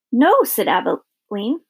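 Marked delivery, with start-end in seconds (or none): 0.1-0.4 high, loud cry of protest; shocked protest. A narrator's reading. Abilene protests at the warthog being shot, high and loud. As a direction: cry out against what was just told.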